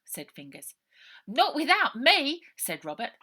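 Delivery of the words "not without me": loud high protest; firm high-pitched objection; insistent. A narrator's reading. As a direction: cut in loudly to insist. Robert insisting to go too.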